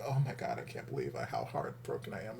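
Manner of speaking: lightly voiced mock heartbreak dropping low; a hint of sulky heartbroken Sid. Viewer imitating Sid's heartbroken look, low and soft. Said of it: "looking like".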